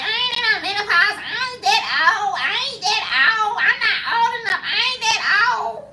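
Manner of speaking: mock the woman who won't admit her age; women in denial. Imitating women who deny menopause, high and laughing. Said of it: "a lot of women be like".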